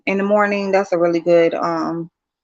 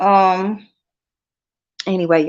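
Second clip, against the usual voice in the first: usual speaking voice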